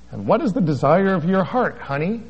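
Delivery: high, sugary preacher-lady voice; Frances's sweet voice. Retold or imitated. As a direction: coo it like a sweet old evangelist. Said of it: "That's how she talks".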